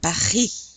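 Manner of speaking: "Paris" as pa-REE with a strong throaty R; French wet R. Shown as a demonstration. Teacher repeats for learners, French "Paris" with wet R. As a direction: scrape the R in "Paris", drop the S. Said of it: "You really hear the R".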